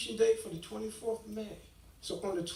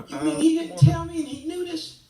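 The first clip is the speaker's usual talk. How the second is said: loud, high outraged disbelief; incredulous outburst; 6 semitones higher, 9 dB louder than usual. Retold or imitated. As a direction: shout it, stunned he kept it back. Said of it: "I'm like".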